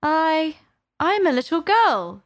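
high, hesitant answer; rather doubtful. A narrator's reading. Alice answering the pigeon, doubtful. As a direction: offer it uncertainly, pausing after the first word. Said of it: "said Alice rather doubtfully".

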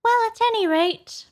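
very high-pitched; high storybook Dormouse. A narrator's reading. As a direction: pipe up high as a little creature. Hatter quotes the Dormouse, high.

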